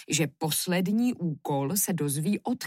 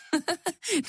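short, high-pitched laugh; joking laugh; 11 semitones higher than usual. A narrator's reading. Queen takes her husband's request as a joke, light laugh. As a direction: laugh lightly before a jest. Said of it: "z žertu povídá".

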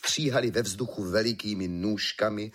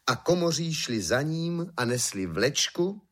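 ordinary delivery, nothing marked

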